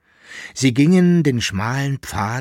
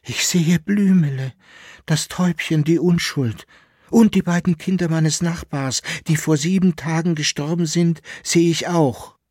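high awed wonder; 7 semitones higher, 4 dB louder than usual. Acted. Awed by dead children's spirits.

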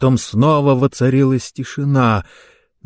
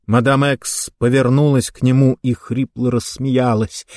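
ordinary delivery

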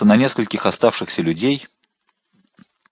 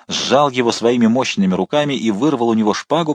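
usual speaking voice

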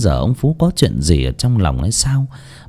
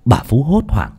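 plain reading voice

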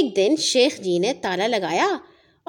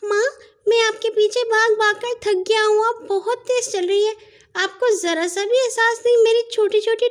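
put-on much higher child voice of a weary baby camel; tired baby camel; 8 semitones higher than usual. A narrator's reading. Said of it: "اونٹنی کے بچے نے اپنی ماں سے کہا". (تھکا ہوا اونٹنی کا بچہ، اونچی بچگانہ آواز)

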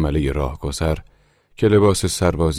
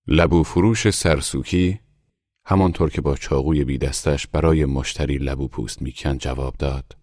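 plain reading voice

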